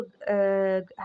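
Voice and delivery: ordinary talk, nothing marked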